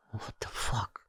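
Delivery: stunned, whispered curse; whispered disbelief at the helicopter; shocked whisper. Retold or imitated. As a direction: breathe it out in disbelief.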